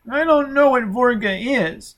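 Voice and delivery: put-on high, strained child's voice; a scared little boy pleading; frightened child.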